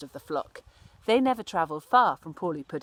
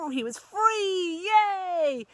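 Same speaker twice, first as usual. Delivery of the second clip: much higher, slow exultant cheer; joyful cry; 12 semitones higher, 5 dB louder, much slower than usual. Acted. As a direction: soar up with pure delight.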